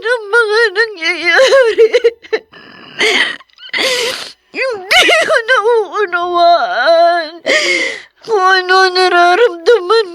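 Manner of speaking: slow, broken sobbing; weeping, half laughing in despair. Acted. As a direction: force each word out through sobs. Overwhelmed daughter breaking down.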